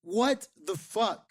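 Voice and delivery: high-pitched, stunned questioning; incredulous disbelief. Acted. Host reacting to an actor's debut, incredulous. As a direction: react in disbelief, pausing between bursts.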